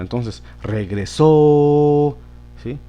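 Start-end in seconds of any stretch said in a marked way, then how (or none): 0.6-2.2 "regresó" with a long, raised SÓ; stress on last syllable. Shown as a demonstration. Teacher confirming the accent's place, long stress on SÓ in "regresó". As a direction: hold the final O of "regresó" loud and level. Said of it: "en la última sílaba".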